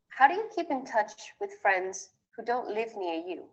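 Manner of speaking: usual speaking voice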